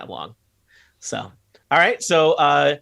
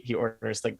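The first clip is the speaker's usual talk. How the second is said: ordinary talk, nothing marked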